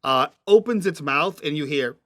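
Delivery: usual speaking voice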